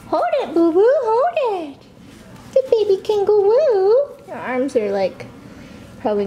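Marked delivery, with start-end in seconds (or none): 0.1-3.9 high singsong encouragement. Real, not acted. Mom urging baby to hold kangaroo, high coaxing. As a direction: lift up and urge him on.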